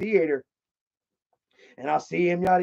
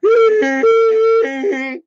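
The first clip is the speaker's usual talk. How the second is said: big burst of laughter; loud high laugh; 8 dB louder than usual. Real, not acted. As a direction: crack up laughing. Laughs after manager quote.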